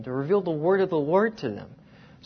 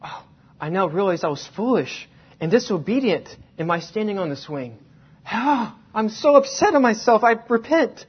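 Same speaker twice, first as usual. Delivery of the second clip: mock repentant; 4 semitones higher, 5 dB louder than usual. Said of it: "she was not thinking".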